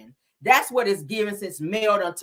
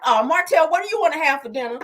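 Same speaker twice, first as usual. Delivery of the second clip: higher and louder, a mock sweet question to Martell; mock sweet wife voice; 7 semitones higher, 5 dB louder than usual. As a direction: play the sugary wife asking about dinner, a bit fast. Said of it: "So she's like".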